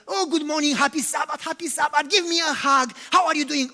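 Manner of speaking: high, loud, gushing hello; hammed-up church greeting; fake cheery greeting. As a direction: greet everyone with put-on cheer. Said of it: "let's pretend we are okay".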